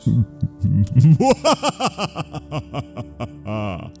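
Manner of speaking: sinister drawn-out villain laugh; evil laugh. Acted.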